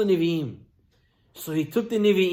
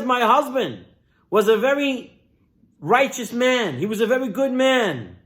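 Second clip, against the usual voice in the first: raised, higher-pitched impassioned plea; crying out; 4 semitones higher than usual. Retold or imitated. Widow crying out to Elisha over debts, raised pleading voice. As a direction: plead urgently, pitched up, as a retold outcry. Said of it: "she cries out. And she says".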